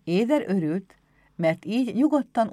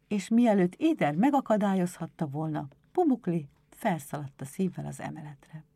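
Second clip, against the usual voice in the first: usual speaking voice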